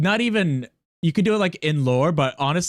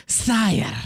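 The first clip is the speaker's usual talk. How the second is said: snake voice; 9 semitones higher than usual. Retold or imitated. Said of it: "It has a snake voice".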